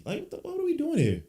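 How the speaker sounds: what are we even doing, disgusted; exasperated disbelief. Real, not acted. Fed up with his team.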